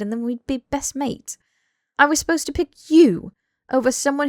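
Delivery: high, loud exasperated outburst; furious, rising tirade; barreling rant. Acted. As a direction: let it all pour out at full volume. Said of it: "Harry barreled through".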